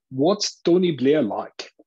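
a keen audience member's slow South African question; South African accent. Retold or imitated. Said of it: "said in a South African accent".

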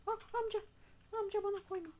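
put-on high, pleading whine at the old man; mock pleading. Gamer begging a game character to stop, high mock plea. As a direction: beg the old man not to, in mock panic.

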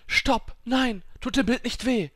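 high, loud panicked pleading; desperate cry. A narrator's reading. Girl begging insects to spare grandmother's picture, high desperate shout. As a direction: cry out to stop them, voice shooting up.

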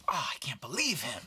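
breathy hushed whisper; whispered aside. Retold or imitated. Actor whispering to a castmate about the producer. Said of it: "she was like".

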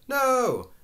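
a high, loud cry of shock. Acted. Linda hears Biff flunked.

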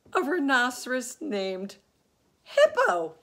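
soaring high and loud with glee; crying out with laughter. Acted. Fluffy crying out with laughter at the rhino's name. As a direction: shriek it in pure delight. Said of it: "Fluffy cried".

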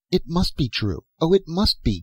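high, urgent insistence; crying out. A narrator's reading. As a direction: plead earnestly, pitched well up. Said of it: "Galatea cried".

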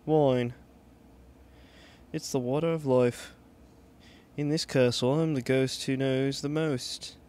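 British ghost holding forth; playful British character accent. Acted. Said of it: "giving them a British accent".

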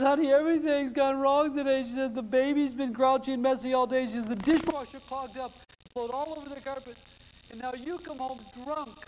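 a lightly voiced frazzled wife pouring out her day; upset wife. Sobbing wife voiced in joke.

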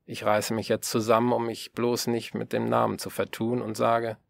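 normal talking voice

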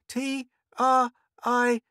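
put-on high, loud child spelling slowly. A narrator's reading. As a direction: spell it out letter by letter, high-pitched. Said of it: "spelled Mink, slowly".

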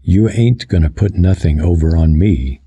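ordinary delivery, nothing marked

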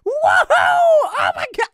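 loud high whooping; thrilled yell mid-ride; excited shout. Real, not acted. Riding down slide.

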